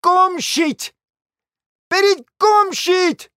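very high, exasperated cry; desperate shout. A narrator's reading. Hostess at the edge of despair ordering guests out, shouting. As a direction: throw them out with a high-pitched yell. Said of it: "فریاد برآورد".

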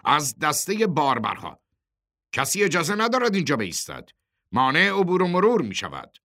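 high, emphatic official objection; forceful assertion. A narrator's reading. Porters' boss warns the chairman about blocking traffic, forceful. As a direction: lay down the rule firmly.

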